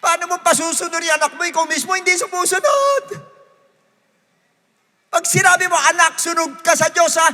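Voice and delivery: loud, high preaching; preaching at full force. Acted. Preacher urges parents to obey God, shouting.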